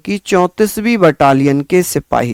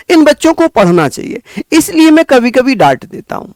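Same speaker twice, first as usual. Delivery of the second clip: high-pitched, fast, heartfelt urging; impassioned plea; 8 semitones higher, faster than usual. Real, not acted. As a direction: plead earnestly with students like younger siblings, words rushing out. Teacher's earnest plea to students. (भावुक होकर, ऊँची आवाज़ में तेज़ी से समझाते हुए)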